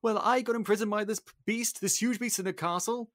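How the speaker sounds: high-pitched, rushed account; flustered old father explaining himself; acted breathless-with-worry report; Maurice. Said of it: "okay, Maurice, what happened?".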